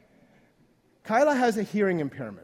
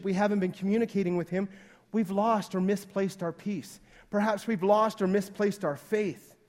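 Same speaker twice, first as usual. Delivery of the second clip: normal talking voice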